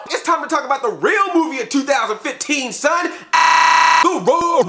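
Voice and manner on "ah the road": high, loud drawn-out call; hammed-up high announcement; mock dramatic exclaim. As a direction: belt it like a movie trailer.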